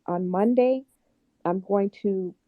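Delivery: ordinary talk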